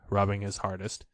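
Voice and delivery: ordinary delivery, nothing marked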